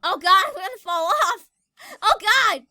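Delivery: loud panicked cries ending in a scream; screaming while falling. Real, not acted. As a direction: shriek as you slip off the edge. About to fall off in game.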